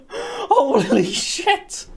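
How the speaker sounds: drawn out laughing holy shit; laughing amazement. Real, not acted. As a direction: laugh it out in disbelief.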